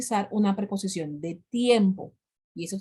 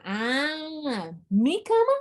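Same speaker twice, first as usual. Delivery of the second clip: much higher, rising "¿mi cama?"; an exaggerated aha, pitched way up; mock surprise; 5 semitones higher, much slower than usual. The teacher playing a listener who finally understands, with high surprise.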